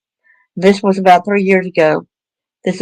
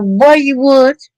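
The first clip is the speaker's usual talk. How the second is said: higher-pitched mock scolding of a child; reprimanding parent; 5 semitones higher than usual. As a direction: brush the kid off like an impatient parent. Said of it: "Don't reprimand them like".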